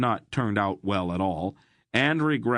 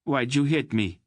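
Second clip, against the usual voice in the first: mild tearful demand; 6 semitones higher than usual. Acted. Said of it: "the redhead demanded tearfully".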